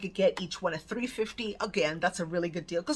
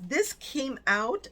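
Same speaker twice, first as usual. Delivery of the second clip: usual speaking voice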